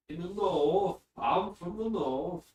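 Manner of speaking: high, drawn-out Northern English accent. Shown as a demonstration. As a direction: put on a broad North of England lilt. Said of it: "what do they sound like?".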